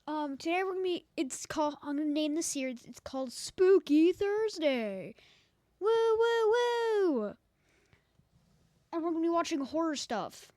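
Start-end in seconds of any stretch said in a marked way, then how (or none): 2.8-5.1 spooky voice. Pretend. Announcing spooky series name. 5.8-7.3 loud, high ghostly wooing. Pretend. Ghost noise for spooky series.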